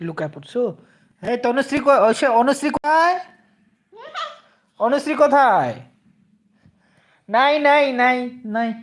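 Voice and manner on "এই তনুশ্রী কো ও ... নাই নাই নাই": put-on higher and louder, playfully calling out for the child; mock searching call. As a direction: pretend to hunt for the hidden baby. Playing mother searching for child.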